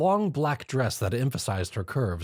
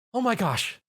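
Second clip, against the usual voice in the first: alarmed gasp; 11 semitones higher than usual. A narrator's reading. Twilight alarmed.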